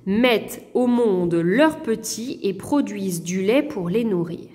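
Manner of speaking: ordinary talk, nothing marked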